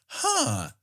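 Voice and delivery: very high, bewildered squeaky voice; baffled actor. Retold or imitated. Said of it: "they're like".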